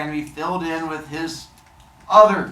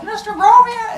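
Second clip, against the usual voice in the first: high, loud cry for teacher; mock calling out; 8 dB louder than usual. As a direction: imitate kids yelling for help. Kids calling out in alarm.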